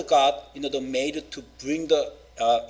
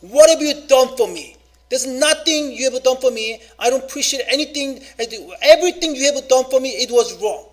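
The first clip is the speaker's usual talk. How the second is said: loud, higher angry outburst at parents; child lashing out at parents, much louder and higher; rebellious child yelling; 8 semitones higher, 7 dB louder than usual. Retold or imitated. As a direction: shout it like a resentful teenager. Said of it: "if a child say".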